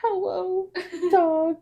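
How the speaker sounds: put-on high, cooing little-kid voice; imitating toddler greeting. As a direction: greet a toy like a sleepy toddler.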